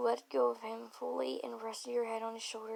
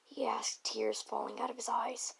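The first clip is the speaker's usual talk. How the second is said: ordinary delivery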